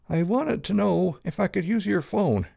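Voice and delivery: put-on high, dry, embarrassed young woman; defensive and ashamed. A narrator's reading. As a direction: ask sheepishly, ashamed to intrude. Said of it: "Jill's embarrassed voice sounded dry".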